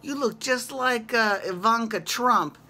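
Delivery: lightly voiced starstruck stranger; a hint of an eager fan; imitating Italian guy. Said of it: "this one Italian guy's like".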